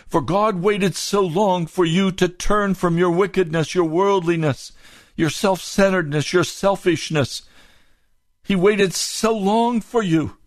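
high, urgent preaching; impassioned preaching. Real, not acted.

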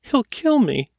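put-on panicked kid begging for safety; small pleading voice. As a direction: blurt it out in terror. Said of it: "in a small, pleading voice".

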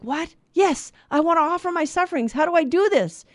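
put-on a boy jumping at the chance; excited little boy. Priest's question answered by an eager little boy. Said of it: "And the little boy is like".

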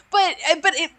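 ordinary talk, nothing marked

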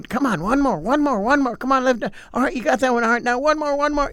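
high, driving gym-coach push; trainer urging. Retold or imitated. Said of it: "they're exhorting them".

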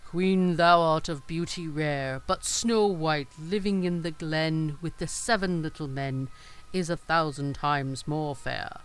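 slow, loud, otherworldly mirror pronouncing; magic mirror voice. A narrator's reading. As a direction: intone it slowly like an oracle. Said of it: "And the glass answered".

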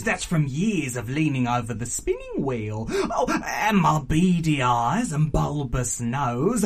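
hammed-up aunt with high whooping swoops; raucous, gleeful auntie. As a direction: parade each deformity with relish, chuckling.

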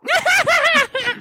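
sudden high-pitched mock cackle; mimicked random kitchen laughing fit; imitated mom's laugh. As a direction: burst out in a shrill imitation laugh. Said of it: "my mom, who was in the kitchen".

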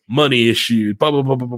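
ordinary talk, nothing marked

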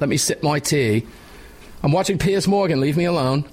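mock English accent, laughing at the end. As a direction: shoo them off like a pampered Englishman. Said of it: "You don't pick up the English accent when you're drinking tea?".

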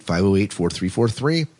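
ordinary talk, nothing marked

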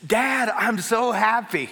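drawn-out, high and excited, imitating a thrilled son; Bolton. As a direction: act out a boy overjoyed at his dad. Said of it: "he looks over at me, and he's like".

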